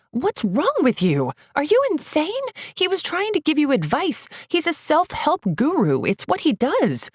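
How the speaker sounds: outraged friend berating the puncher; furious scolding. Acted. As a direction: tear into him, voice up high. Yelling at Josh after punch.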